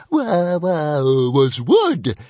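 high, loud wailing creature voice; whining little dragon. Acted. Pet dragon protesting to its master, whining. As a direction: whine like a scolded pet dragon.

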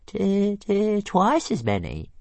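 high stuttering dragon, mock modest; squeaky slow stammer; Toothless modest. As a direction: boast while pretending modesty. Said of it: "he said modestly".

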